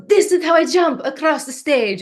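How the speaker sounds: high, loud goofy Mexican wrestler voice; Nacho Libre voice. Retold or imitated.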